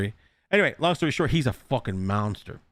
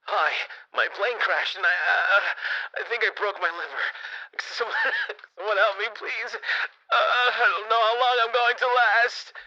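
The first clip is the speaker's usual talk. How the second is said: high, pleading voice of a hurt plane crash victim, near tears at the end; hammed-up stranded survivor calling for help; panicked crash survivor; 8 semitones higher than usual. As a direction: beg for help over the radio like you might not last. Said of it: "I was like".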